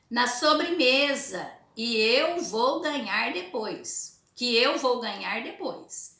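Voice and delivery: put-on slow, emphatic reading to children; story read-aloud. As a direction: read it out word by word for pupils. Reads comic aloud to pupils.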